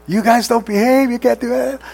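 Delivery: half-acted blow-up at misbehaving children; mock parent yelling. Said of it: "you're here yelling at the top of your lungs".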